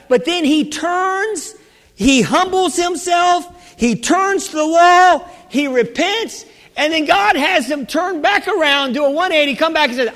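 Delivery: high, intense preaching push; rising fervent preacher voice; building preaching. Acted. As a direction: lift the congregation as the story turns.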